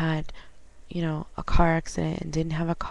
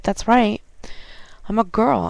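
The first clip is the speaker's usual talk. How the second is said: sarcastic; 5 semitones higher, 5 dB louder than usual. Retold or imitated. Sarcastic quote to coworker.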